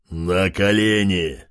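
slow, menacing command from a monster; threatening shout. A narrator's reading. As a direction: bellow it like a tyrant king. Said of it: "угрожающе выкрикнул он".

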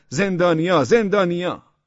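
high, loud calling cry; alarmed shout. A narrator's reading. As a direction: holler it down the street. (داد زدن بلند و هراسان)

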